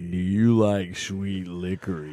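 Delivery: low, drawn-out teasing question; mock seductive. Co-host joins the erotic joke, slow and mock-sultry. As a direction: stretch it out like a fake come-on.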